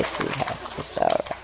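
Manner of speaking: soft whispered soothing; whispered comfort. Real, not acted. Owner whispering comfort to her scared parrot. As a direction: breathe the words to calm him.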